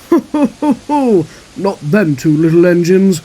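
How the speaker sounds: loud, high ho-ho then scornful remark; blustering, mocking big engine; scoffing Gordon. A narrator's reading. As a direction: laugh them off boomingly.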